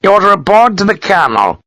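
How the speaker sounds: high, clipped officer's order; the colonel's nephew summons Sharp with stern seriousness; stern Gibbons. A narrator's reading. Nephew summoning Sharp, stern and high. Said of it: "filled with the seriousness of the moment".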